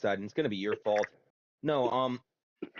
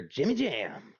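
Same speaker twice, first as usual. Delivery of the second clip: mock holler from across the place; imitating a distant caller; retold far-off call; 4 semitones higher, much slower than usual. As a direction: sing it out like calling someone from afar. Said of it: "from far away I hear".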